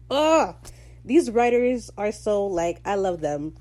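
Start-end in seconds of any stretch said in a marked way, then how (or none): 0.1-0.6 delighted groan. Real, not acted. Reacting to writers' joke.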